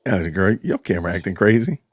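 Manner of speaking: ordinary talk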